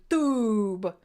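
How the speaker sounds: TUBE drawn out long and heavy; exaggerated emphasis. Real, not acted. Host jokingly renaming a new viewer, overemphasized Tube. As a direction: lean hard and slow on Tube. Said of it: "put some emphasis on the wrong syllable".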